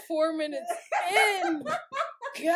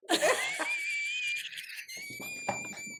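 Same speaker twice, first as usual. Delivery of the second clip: shrieking laugh; 6 dB quieter than usual. Real, not acted. Loud cackling laugh.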